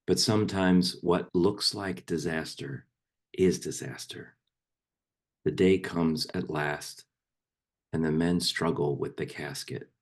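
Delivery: poem read aloud in measured, fuller voice; firmly projected poetry reading; poem recitation. Acted. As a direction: recite it line by line, letting phrases fall at the ends. Recites a poem to a meditation group.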